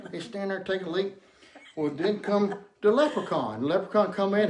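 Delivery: normal talking voice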